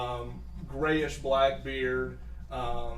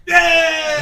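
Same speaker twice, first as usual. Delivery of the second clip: very high, loud cheer; gnome crowd cheer; 14 semitones higher, 14 dB louder than usual. Acted. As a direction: cheer it with glee. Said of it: "they're like".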